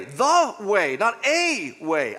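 "the way" punched loud, "not a way" slow and high; stress on the vs a. Shown as a demonstration. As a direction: lean hard on "the", then slowly "not a way". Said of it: "Definite article way".

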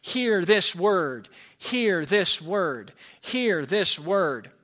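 very slow, each "hear" leaned on; solemn proclamation. Acted. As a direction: toll it out like a bell, slowly. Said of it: "and five, we hear".

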